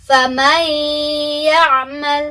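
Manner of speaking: FAMAN YA'MAL recited with N merged into a long humming Y; correct idgham recitation. Shown as a demonstration. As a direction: demonstrate فَمَنْ يَعْمَلْ as "famayy-ya'mal", hummed two beats. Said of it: "dengungannya ditahan dua ketukan".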